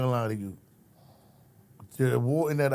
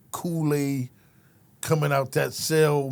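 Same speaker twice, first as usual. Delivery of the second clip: normal talking voice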